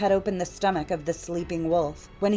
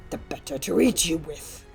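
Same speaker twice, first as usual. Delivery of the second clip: granny disguise dropped for the wolf's own voice; dark storybook wolf; menacing wolf; 2 semitones lower than usual. A narrator's reading. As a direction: deliver the threat as the hungry wolf.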